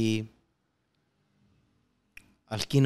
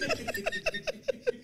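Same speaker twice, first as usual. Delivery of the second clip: high, helpless laughter; real laugh, running on; 7 dB quieter than usual. Real, not acted. Laughing at his own alarm joke, high helpless laugh. Cracking up at the game.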